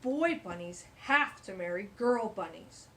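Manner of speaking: loud, stern bug voice; bossy stink bug. Acted. Stink bug scolding the two boy bunnies, loud and stern. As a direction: lay down the rule loudly. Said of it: "said the stink bug".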